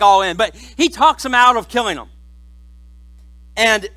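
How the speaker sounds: ordinary talk, nothing marked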